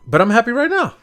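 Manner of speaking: happy burst. Real, not acted. To the podcast partner, a happy outburst. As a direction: blurt it out with joy. Excited declaration, then laughs.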